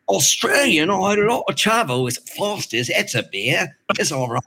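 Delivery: high, hammed-up Australian; exaggerated Australian twang; Australian accent. Shown as a demonstration. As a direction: put on a struggling Aussie accent. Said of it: "I had a little trouble with Australian".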